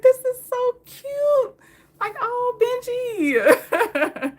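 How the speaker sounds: high slow cooing at a dog. Real, not acted. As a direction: coo over the sweet dog.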